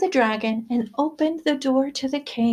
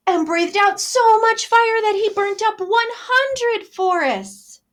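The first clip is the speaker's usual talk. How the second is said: dramatic fire blast; 10 semitones higher, 3 dB louder, slower than usual. A narrator's reading. Dragon breathes fire again.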